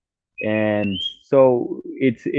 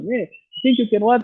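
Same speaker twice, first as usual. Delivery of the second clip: mock angry shout, voice jumping much higher; imitating her shouting; 12 semitones higher than usual. Man retelling a stranger's wife shouting back over the phone, mock high-pitched yell. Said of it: "And she starts shouting".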